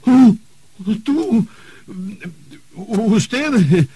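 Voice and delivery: high astonished gasps of disbelief; stunned to hear who's calling; oh, you? said in high disbelief; shocked. Acted.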